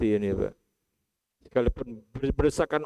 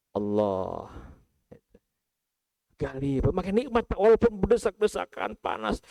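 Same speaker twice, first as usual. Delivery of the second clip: usual speaking voice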